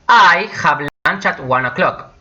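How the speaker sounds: unhurried, with a lifted I; slow model sentence. Shown as a demonstration. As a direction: present it carefully to learners. Teacher models an example sentence.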